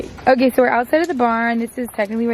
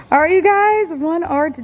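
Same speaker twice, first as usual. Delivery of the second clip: excited; 8 semitones higher, 4 dB louder than usual. Real, not acted. Excited reveal to viewers.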